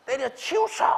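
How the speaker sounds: high, loud mock tantrum yell; indignant shout. Child rejecting a tiny allowance from parents, indignant high shout. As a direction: shout it back like a child refusing outright. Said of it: "이러고 막 고함 지르고".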